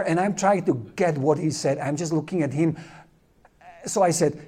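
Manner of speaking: usual speaking voice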